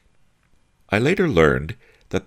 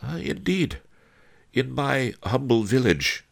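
sweet; 11 semitones higher than usual. A narrator's reading. Master Li humouring the boastful thug, high and sweet. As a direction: answer the bully with sugary politeness, high-pitched. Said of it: "Master Li said sweetly".

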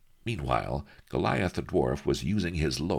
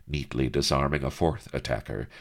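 ordinary delivery, nothing marked

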